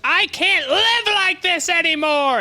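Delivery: high-pitched, slow, wailing mock cry of despair; exasperated old woman shrieking at her boss, hammed up; mock scream from the receptionist. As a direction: ham up a fed-up old receptionist yelling down the hall. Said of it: "she screams at him down the hall".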